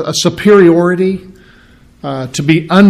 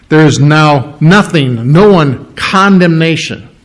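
slow, weighted There is now nothing; emphatic nothing. Shown as a demonstration. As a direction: lean on nothing, drawn out. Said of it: "is very emphatic".

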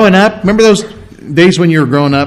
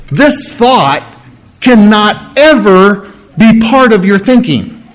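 higher, weighty, word-by-word insistence; emphatic refusal; 4 semitones higher, slower than usual. Acted. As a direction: drive home CANNOT EVER, each word stressed. Preacher acts out emphatic refusal.

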